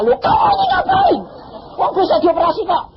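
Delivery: high, loud, startled outburst; shocked shout. Retold or imitated. Husband shouting in shock at his crawling wife.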